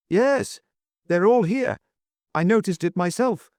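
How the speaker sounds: high, eager cry; young duke's cry. A narrator's reading. Young duke confirming the waiters are here, excited. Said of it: "cried the young duke".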